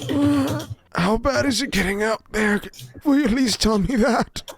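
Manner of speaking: put-on high-pitched old man voice; old prisoner asking. Game master voices old prisoner.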